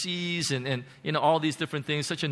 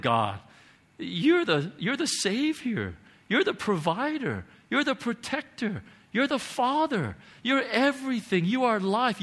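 high, swooping declarations to God; higher, heartfelt prayer of surrender; fervent praise; 6 semitones higher than usual. Retold or imitated. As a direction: build from tender realization to impassioned praise. Retold surrender, rising praise.

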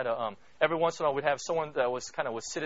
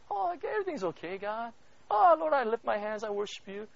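mock fake worship; 8 semitones higher than usual. Mocking fake piety to God.

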